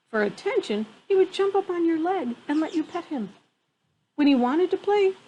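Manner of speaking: ordinary delivery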